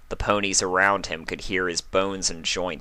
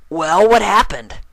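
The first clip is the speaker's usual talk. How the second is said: high, loud eager pony voice; much higher, louder tomboy pony; pressing Rainbow Dash; 13 semitones higher, 9 dB louder than usual. A narrator's reading. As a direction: push her for the gossip. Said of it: "Rainbow Dash pressed her".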